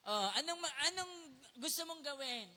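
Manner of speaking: ordinary talk, nothing marked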